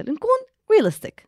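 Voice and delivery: "realistic" stretched out high and slow; emphasized word. Real, not acted. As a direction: land hard on "realistic". Emphatic conclusion.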